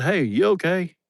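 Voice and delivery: high, gently checking voice; concerned call. Retold or imitated. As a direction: call out to him with mock concern. Checking on overheated helper.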